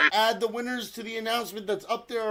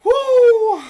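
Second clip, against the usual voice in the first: high, loud "woo"; high-pitched cheer; loud whoop; 12 dB louder than usual. Real, not acted. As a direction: let out a long cheering whoop. Cheers the race start.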